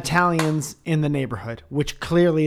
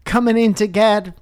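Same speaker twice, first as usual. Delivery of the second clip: cheery shop worker; 8 semitones higher, 5 dB louder than usual. Retold or imitated.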